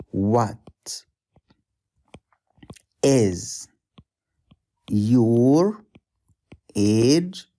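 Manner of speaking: What, is, your, age with long pauses; drawn out word by word for the learner; slow word by word. Shown as a demonstration. Teacher spacing out "What is your age?" for students. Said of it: "can you say".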